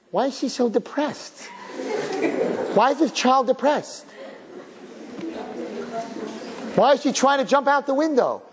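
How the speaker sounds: high, plaintive mock puzzlement; bewildered parent. Parents asking the therapist about depressed child, mock bewilderment. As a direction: ask in clueless, whiny dismay.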